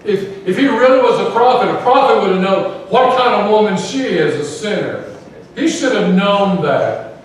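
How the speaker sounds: a Pharisee sneering at the sinful woman, disgusted. Retold or imitated. Pharisee scorning Jesus to himself, disgusted and loud. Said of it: "do you see the disgust?".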